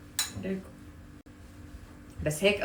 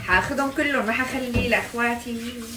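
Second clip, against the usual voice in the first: playful greedy exclamation; 5 semitones higher, 5 dB louder than usual. Real, not acted. Greedy joking claim.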